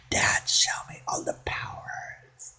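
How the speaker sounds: whispered plea to Dad; hushed childish whisper. Pretend. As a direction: breathe it like a secret wish.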